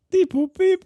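high, loud robot beeps; robot beeping. Pretend. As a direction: beep like a toy robot. Imitating service robot.

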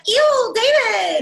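high, loud, slow whiny ew David; Alexis impression. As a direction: drag out a spoiled sister's disgust. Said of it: "she's always like".

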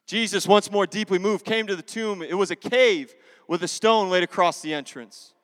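loud, dramatic Bible reading; preacher's scripture reading. Acted. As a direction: proclaim the passage to a congregation. Reads scripture aloud to congregation.

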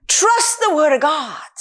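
ordinary delivery

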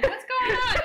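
high, loud retold yelp through laughter; hammed-up panic, laughing; mock alarm. Retold alarm as tub water drains.

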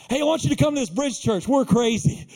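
high, punchy recruiting pitch; excited invitation. Acted. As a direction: invite them with big enthusiasm. Acts out enthusiastic church invitation.

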